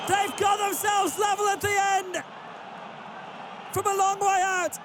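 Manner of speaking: high excited goal call; goal celebration shout. Real, not acted. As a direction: yell it as the ball hits the net. Late equaliser goes in.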